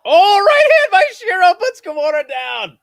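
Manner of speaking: screaming as the knockdown lands; excited shout. Real, not acted. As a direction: erupt with a huge high yell. Knockdown called live.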